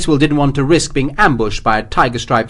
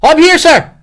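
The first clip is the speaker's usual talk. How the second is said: high, loud Irish officer's reply; confident call; 9 dB louder than usual. A narrator's reading. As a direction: call back boldly from a distance. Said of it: "Fitzgerald called confidently from the darkness ahead".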